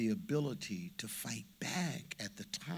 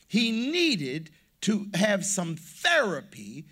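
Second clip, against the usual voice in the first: louder and higher, rising preacher's fervor; full-force preaching; 6 semitones higher, 8 dB louder than usual. Acted. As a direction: preach it out to the congregation.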